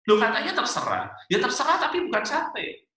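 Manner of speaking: high, mock-indignant protest; exasperated bickering. Husband and wife arguing over satay, exasperated. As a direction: act out a couple bickering, pitch climbing. Acted couple quarrel over food.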